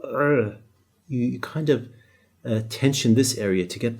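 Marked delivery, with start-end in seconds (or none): 0.0-0.6 er squeezed from a tightened throat; tense the throat on er; tight-throat ain. Shown as a demonstration. Teacher demonstrating the ain with a tight throat. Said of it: "tighten your throat when you say it".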